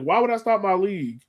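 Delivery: ordinary talk, nothing marked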